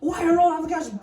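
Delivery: fast high mock panic shout; panicked dad. Dad scolding grandkid in a mock panic. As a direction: rattle it off in a fluster. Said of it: "He said".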